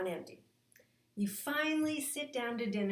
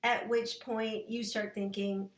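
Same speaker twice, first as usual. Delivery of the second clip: normal talking voice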